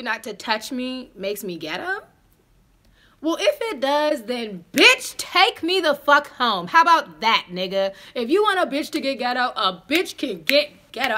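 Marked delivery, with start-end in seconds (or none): 3.2-11.1 loud, sassy, slowed on the command; defiant tell-off. Retold or imitated. Telling the date to take her home, defiant.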